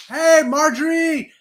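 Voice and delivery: high, loud, drawn-out hail; called-out greeting. Real, not acted. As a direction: holler hello to an arriving friend. Calling out greeting to viewer.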